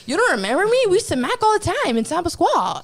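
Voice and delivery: high, insistent mock protest; imitating insistent stranger. Stranger claiming old romance, high and insistent. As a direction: press the claim with pitch swinging up.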